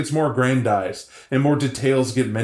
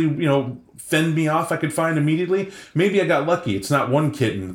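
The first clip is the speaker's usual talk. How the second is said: ordinary delivery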